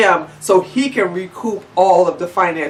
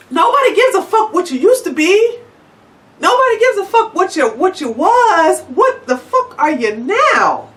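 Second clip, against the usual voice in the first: raised, higher voice, hammering each phrase; ranting; 11 semitones higher than usual. Real, not acted. Venting at the organization, a heated rising rant. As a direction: tell him off, heated.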